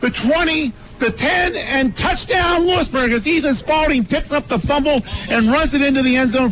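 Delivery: high excited fumble return call; touchdown call. Real, not acted. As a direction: build as he runs it in.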